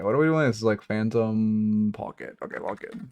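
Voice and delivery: normal talking voice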